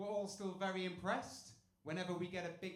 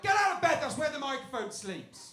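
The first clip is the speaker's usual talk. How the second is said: loud, high indignant order; mock shout; 11 semitones higher, 18 dB louder than usual. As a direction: yell at her to get out. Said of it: "I kept on being like".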